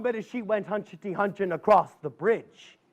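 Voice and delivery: ordinary delivery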